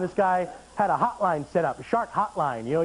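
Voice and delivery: ordinary delivery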